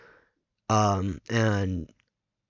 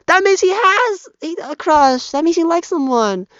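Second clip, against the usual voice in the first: much higher and louder, gleeful squeal; excited teasing; 11 dB louder than usual. Retold or imitated. Cousin teasing Matthew about his crush, high gleeful squeal. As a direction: squeal it like you just caught a secret. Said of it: "my cousin's like".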